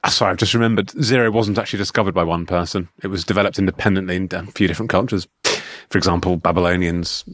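mock clever re-recorded take; hint of a know-it-all lecturer; voice changes mid sentence. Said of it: "I'm just gonna re-record this bit".

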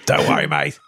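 low Australian "Don't worry, mate"; Russell Crowe impression. Co-host guessing the actor, in a low Australian voice. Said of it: "Was it Russell Crowe?".

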